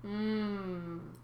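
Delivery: long hummed moo; cow-like lowing. A narrator's reading. Sea cow answers the talk of sacrifice, hummed lowing. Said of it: "and Bessie lowed".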